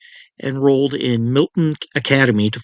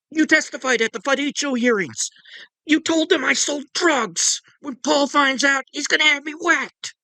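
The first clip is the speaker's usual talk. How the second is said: very high, strained accusation; high-pitched angry tirade; Roy's drunken shout. A narrator's reading. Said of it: "he shouted".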